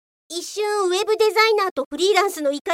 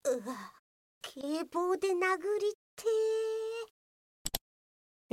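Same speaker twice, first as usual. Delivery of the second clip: much softer grumble under the breath; muttered aside; 2 semitones higher, 12 dB quieter, much slower than usual. Acted. Office police mutters his urge to hit the rude worker. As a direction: mutter it to yourself, drawing out the end.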